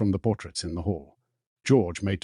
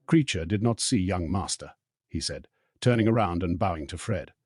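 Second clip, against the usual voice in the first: plain reading voice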